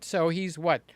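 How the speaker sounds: normal talking voice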